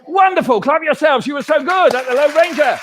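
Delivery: high, loud praise; delighted praise. Real, not acted. Praising the children.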